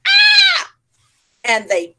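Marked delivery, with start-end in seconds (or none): 0.1-0.7 a loud, very high startled shriek; monkeys' scream. Acted. Monkeys startled by the farmer, shrieking back. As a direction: shriek right back at him. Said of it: "the monkeys went".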